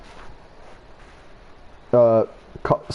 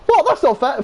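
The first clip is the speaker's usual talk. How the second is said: indignant protest; 11 semitones higher than usual. Acted. Acted second protest at detention.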